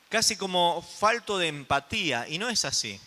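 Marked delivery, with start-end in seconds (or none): none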